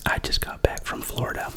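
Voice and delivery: ordinary delivery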